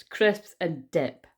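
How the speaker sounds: drawn out slowly, savouring the words; slow chuckling repeat of Crisps and dip; slow amused repeat. Real, not acted. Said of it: "That's hilarious".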